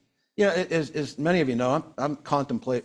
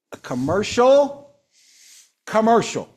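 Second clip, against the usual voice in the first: COMMERCIAL drawn out high and loud, then repeated; emphatic announcement; 6 semitones higher, 5 dB louder, slower than usual. Real, not acted. Announcing big news to colleagues, stress on "commercial". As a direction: land hard on "commercial", pausing before the repeat. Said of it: "Let me repeat that".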